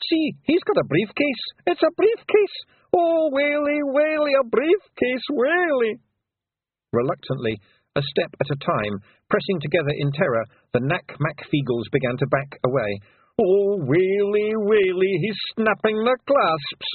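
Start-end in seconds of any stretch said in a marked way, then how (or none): 0.1-6.0 very high, terrified Scots wail; whimpering. A narrator's reading. Pictsy terrified of the lawyer's briefcase, high wail. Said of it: "whimpered a Pictsy". 13.4-16.9 slow, high, dread-filled moan; groaning. A narrator's reading. Daft Woolly watching the clasps snap, slow groaning wail. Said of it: "groaned Daft Woolly".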